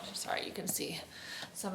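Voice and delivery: whispering. Real, not acted. Aside while adjusting camera.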